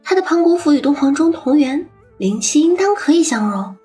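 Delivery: eager suggestion. Acted. Young man proposing a plan.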